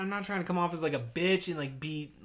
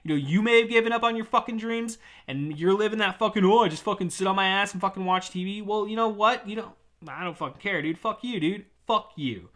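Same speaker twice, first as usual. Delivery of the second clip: higher, louder defiant rant; defiant anger; 5 semitones higher, 4 dB louder than usual. Real, not acted. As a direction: tell the quitters off. Angry rant at quitters.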